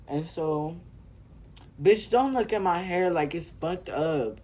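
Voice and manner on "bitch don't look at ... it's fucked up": higher, louder sassy telling-off; mock indignant scolding. Telling viewers off about their looks at hair, indignant. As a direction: scold the onlookers with loud mock outrage.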